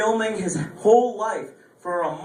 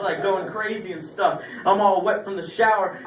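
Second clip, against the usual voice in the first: ordinary talk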